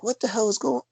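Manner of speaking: high, bewildered disbelief; can't believe what he sees; baffled surprise. Retold or imitated. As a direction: pitch shoots up in confusion. Confused realization retold.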